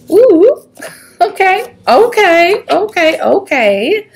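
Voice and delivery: high squealing okays, winding down; thrilled reaction to the cards, calming herself; excited squeal. Real, not acted. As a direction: ooh okay okay, excitedly. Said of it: "Calm down".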